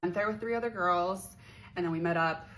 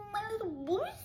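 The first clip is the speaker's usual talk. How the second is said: very high, small squeaky voice; high-pitched coy squeal; mock coy. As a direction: squeak it with put-on innocence. Acts coy reaction to compliment.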